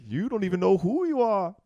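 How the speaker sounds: high, put-on Rafiki voice; wise sage. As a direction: taunt like the old baboon sage. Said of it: "And he's like".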